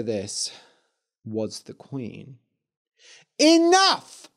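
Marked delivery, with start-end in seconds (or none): 3.4-4.3 high, loud furious shout; angry shout. A narrator's reading. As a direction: cut him off, furious. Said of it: "she said".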